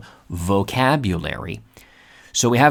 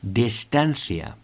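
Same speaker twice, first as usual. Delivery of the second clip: ordinary delivery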